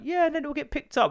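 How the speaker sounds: high sing-song reassurance; sugar-coating for the child. Retold or imitated. Vet reassuring the little girl, high sing-song. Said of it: "trying to be a bit like".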